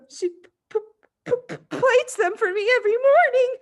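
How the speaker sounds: put-on high, trembling, stammering child; terrified stutter. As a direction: stammer it out, paralysed with fright. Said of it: "managed to stutter".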